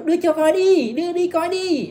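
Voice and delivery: high, pushy whine of please show me; mock eager begging. A man pesters a woman to see the pictures, eager mock begging. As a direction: beg like a kid who wants a peek.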